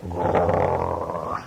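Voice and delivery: low grumpy growl. Acted. Brother growling at his sister. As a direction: growl at her like a bear. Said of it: "Hunter Bear Brother went".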